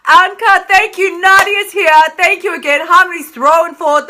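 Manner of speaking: excited shout-outs to the chat; excited greeting. Real, not acted. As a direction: greet each name with delight. Greeting live viewers.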